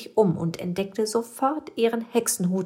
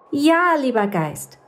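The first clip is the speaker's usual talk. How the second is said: higher, louder, eager cry; excited little witch; 8 semitones higher, 7 dB louder than usual. Acted. Little witch eagerly accepting the ghost's help, high and loud. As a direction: burst out with delight. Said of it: "rief die kleine Hexe begeistert".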